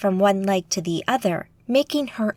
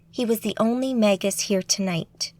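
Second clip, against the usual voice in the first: ordinary delivery, nothing marked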